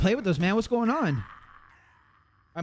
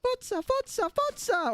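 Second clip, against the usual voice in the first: Futza squealed very high, softer; 3 dB quieter, slower than usual. Shown as a demonstration. Streamer shows his own word, squeaky high Futza. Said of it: "we say Futza around here".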